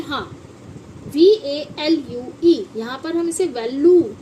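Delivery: normal talking voice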